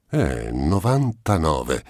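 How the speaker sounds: drawn out slowly, relishing the number; slow savouring. Acted. The miser to the notary, slowly savouring the good news. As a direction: let the figure linger, slowly.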